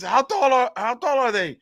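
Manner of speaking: high, urgent Cajun buddy; comic high-pitched Cajun; Cajun joke character. Acted. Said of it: "get into this character here".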